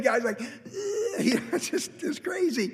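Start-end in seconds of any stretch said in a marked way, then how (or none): 0.4-1.1 a squeaky, helpless laugh; real high-pitched giggle; high burst of laughter. Real, not acted. Laugh at absurd scene. 1.2-2.7 high, breathy words through laughter; real laughter breaking the line; laughing while talking. Real, not acted.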